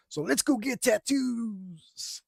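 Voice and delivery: high, slow reckless-buddy voice; lightly voiced wild friend, dragging it out; wild friend's voice. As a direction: pitch the late-night plan like a wild buddy. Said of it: "That's literally how he, how he talks".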